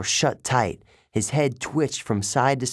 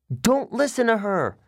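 shouting; 10 semitones higher than usual. A narrator's reading. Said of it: "Annabeth's voice shouted".

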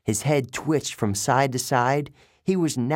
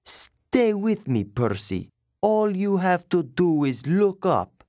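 soothing; 6 semitones higher than usual. A narrator's reading. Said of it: "she told me soothingly".

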